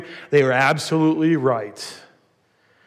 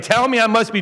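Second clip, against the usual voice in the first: higher-pitched attempt at a New York accent; 6 semitones higher than usual. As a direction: imitate a New York caller. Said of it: "with a really thick New York accent".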